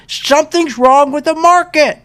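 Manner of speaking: loud, high-pitched shout; screamed warning. Acted. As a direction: scream it out as an urgent alarm. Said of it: "It's screaming".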